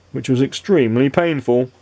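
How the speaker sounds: plain reading voice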